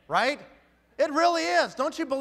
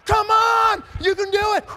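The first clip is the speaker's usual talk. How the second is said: much higher loud coaxing shout; pumping up shout; 11 semitones higher, 10 dB louder than usual. Retold or imitated. Leader pumping the recruit up, loud coaxing shout. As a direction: shout them back into it.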